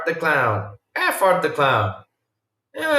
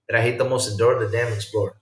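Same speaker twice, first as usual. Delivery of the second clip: ordinary talk, nothing marked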